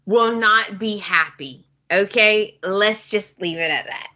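usual speaking voice

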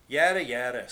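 loud, bored brush-off; dismissive yada. A narrator's reading. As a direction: wave it away loudly. Dismissive character reply.

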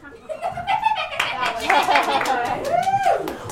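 hammed-up whinnying laugh; horse laugh. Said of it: "can you laugh like a horse".

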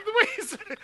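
laughing while talking; words pushed out through laughter. Real, not acted. Laughing hard at the joke.

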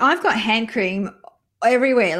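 normal talking voice

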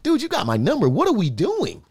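high-pitched, mock-offended challenge; playing the baffled, confronting buddy; put-out friend. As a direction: take them to task, pitched well up. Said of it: "your whole demeanor towards me changed".

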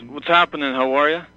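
ordinary delivery

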